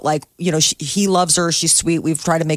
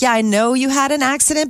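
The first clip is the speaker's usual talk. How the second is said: higher-pitched, mock-impatient brush-off; dismissive bride; 7 semitones higher than usual. Bride brushing off a guest's money trouble, mock dismissive. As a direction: imitate an unsympathetic bride.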